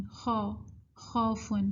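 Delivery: خوفن with a single "aw" vowel; Urdu-style reading. Shown as a demonstration. Teacher repeating the Urdu reading, flattened vowel. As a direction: say خو as a plain "khaw", without gliding. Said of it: "تو ہم کہتے ہیں".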